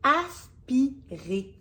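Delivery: "aspirer" slowly drawn out syllable by syllable; word demo. Shown as a demonstration. Teacher shows the new word to a child, slow and drawn out. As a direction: stretch "aspirer" so each sound stands out. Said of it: "j'aimerais te montrer le mot".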